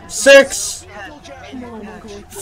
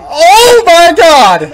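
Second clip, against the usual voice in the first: slow, loud, high exclamation; drawn out in awe; awestruck shout; 7 semitones higher, 6 dB louder, much slower than usual. Real, not acted. Disbelief after catch.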